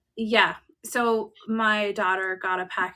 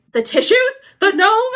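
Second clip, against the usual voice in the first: much higher and louder, each word a stunned question; shocked disbelief; 13 semitones higher, 12 dB louder than usual. Retold or imitated. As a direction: gasp it out as it all clicks. Melanie realising, shocked.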